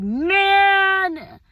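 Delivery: a long, high, loud "Man"; drawn-out cry of triumph; excited shout. Retold or imitated. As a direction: belt it out in delight. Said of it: "I was like".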